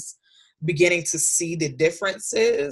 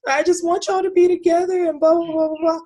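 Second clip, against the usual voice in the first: imitating crying son; 13 semitones higher, 5 dB louder than usual. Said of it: "my son just started crying one night".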